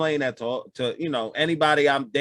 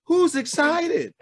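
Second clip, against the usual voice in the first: mock hype; 12 semitones higher, much slower than usual. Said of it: "mock hype".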